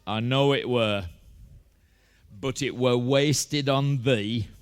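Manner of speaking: put-on slow, broad Yorkshire accent; Freddie Truman. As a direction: answer deliberately in Yorkshire dialect. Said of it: "And Freddie Truman said".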